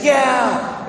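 high mock crowd answer; cheering crowd. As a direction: answer eagerly as a whole crowd. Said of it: "And they say".

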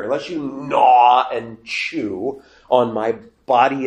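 slow, heavy stress on 'gnaw and chew'; blunt emphasis. Acted. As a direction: draw out 'gnaw' and 'chew' forcefully. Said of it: "make it absolutely clear".

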